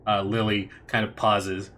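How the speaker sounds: ordinary delivery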